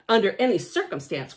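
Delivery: ordinary delivery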